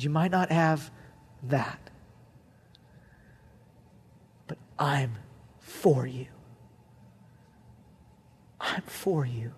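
tender voice of God. Acted. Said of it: "God said".

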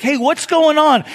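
high, loud call into a crowd. Retold or imitated. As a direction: call out blind, unsure what's happening. Blind man hears crowd.

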